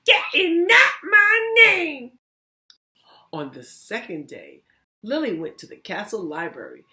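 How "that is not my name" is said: much higher, much louder, slow shouted goblin. Acted. Goblin taunts Lily with a deal, high slow shout. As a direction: bellow it like a gleeful goblin. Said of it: "the goblin said the same thing".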